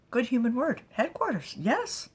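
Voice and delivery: high, loud creature voice; high excited critter voice. Acted. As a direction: chirp it out like an excited little creature. Creature figure praising word.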